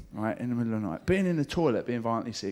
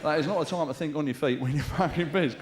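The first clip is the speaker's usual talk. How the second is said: ordinary talk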